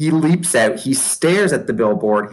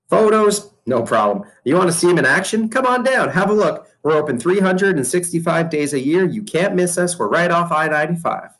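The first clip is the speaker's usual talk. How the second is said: much higher, fast, pushy sales pitch; eager salesman boss; 6 semitones higher than usual. Acted. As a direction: sell it hard to the reporter. Mac sells the zoo on the phone.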